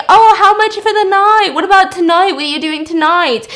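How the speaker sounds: high, loud mock catcall; imitated catcalling. Construction workers to passing woman, hammed-up catcalling. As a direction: hoot it out high, then ease off. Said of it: "construction dudes who are catcalling her".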